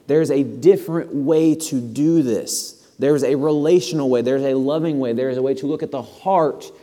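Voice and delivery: lightly voiced Jesus pleading with the stuck; Jesus correcting. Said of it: "when Jesus himself came and said".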